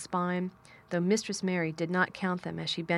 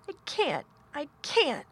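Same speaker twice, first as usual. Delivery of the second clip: high, helpless sobbing voice; gasping sob; 8 semitones higher than usual. A narrator's reading. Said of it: "he gasped and sobbed".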